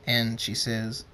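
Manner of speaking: usual speaking voice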